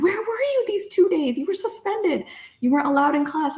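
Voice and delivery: high-pitched imitation of scolding parents; retold parental interrogation, pitched well above your own voice; upset parents. Parents confronting daughter about her suspension, high mock scolding.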